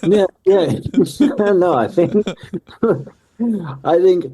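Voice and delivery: real laughter through the words, high and loud; amused, laughing reply. Real, not acted. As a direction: answer while cracking up, pitch jumping up. Laughs at being called anonymous.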